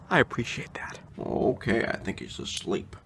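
hushed whisper, sneaking away. Acted. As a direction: whisper so nobody wakes. Sneaking off after God scene.

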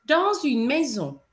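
"Dans une maison" with the S of "Dans" running into "une"; sounded liaison S. Shown as a demonstration. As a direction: let the S in "Dans une" be heard as a Z. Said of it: "you would hear it".

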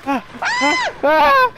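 high excited yelps; excited shrieks. Real, not acted. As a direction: squeal in a panic. Grabbing crown under fire.

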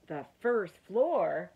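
loud, slow, astonished echo; stretched-out amazed question on "first floor"; incredulous. A narrator's reading. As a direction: repeat it in disbelief, loud and drawn out. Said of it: "could not believe it".

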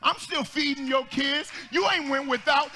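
full-throated gospel preaching; preaching at full force. Real, not acted. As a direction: build it like a Sunday climax. Preaching climax.

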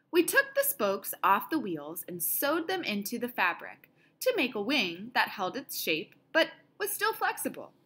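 ordinary delivery

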